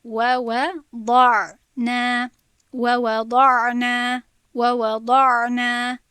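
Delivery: و و ضع نه recited very slowly and loudly, time given to the ع; timed ayn. Shown as a demonstration. Teacher showing students the ayn's timing, slow loud recitation. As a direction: dwell on the ع in و و ضع نه.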